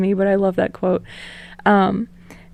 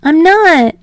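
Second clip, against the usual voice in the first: much higher, louder nervous Deku; nervous little laugh; 9 semitones higher, 8 dB louder than usual. Acted. Deku brushing off Bakugo's jab, high and nervous. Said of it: "with a nervous little laugh".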